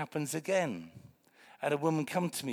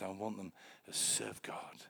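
whisper; 4 semitones lower, 9 dB quieter than usual. Real, not acted. Quiet aside about his kids.

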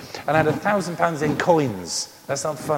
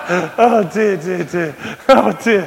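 high, breathy laughing sighs; real amused oh dear; 4 semitones higher, 8 dB louder, slower than usual. Real, not acted. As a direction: catch your breath from laughing. Recovering from laughter.

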